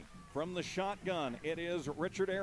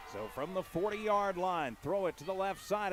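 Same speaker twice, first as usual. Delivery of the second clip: ordinary talk, nothing marked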